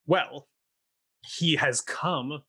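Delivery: plain reading voice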